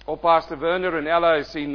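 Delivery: normal talking voice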